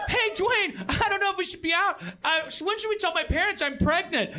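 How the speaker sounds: put-on high, hammy teen voice; mock scared teen girl. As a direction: play a nervous teen in a cheap horror skit. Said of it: "all of a sudden it's like".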